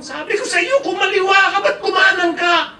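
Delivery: high, loud spousal nagging; mock scolding. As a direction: yell like a fed-up backseat driver. Acted quarrel between spouses driving. (pasigaw na panenermon sa asawa)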